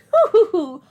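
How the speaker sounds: loud high granny hoo-hoo laugh; a playful grandmother cackle; old lady chuckle. Acted. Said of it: "do an old lady voice".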